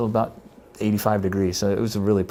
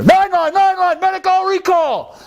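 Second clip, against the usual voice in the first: called-out alert; 5 dB louder, slower than usual. Retold or imitated. The emergency call over speakers.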